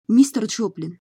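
calling his name across the room. A narrator's reading. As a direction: call out to get his attention. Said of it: "окликнула я".

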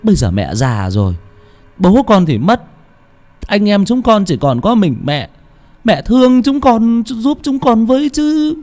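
high, pleading son's voice. Acted. Son pleads with mother.

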